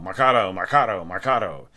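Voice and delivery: "marcato" punched loud, each with a fall; marcato demo. Shown as a demonstration. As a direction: hammer every "marcato" separately. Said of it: "or even marcato".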